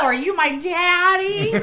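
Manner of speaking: high, slow, breathy-sounding coo at the interviewer; high slow teasing "daddy" line; mock flirt.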